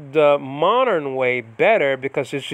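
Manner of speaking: usual speaking voice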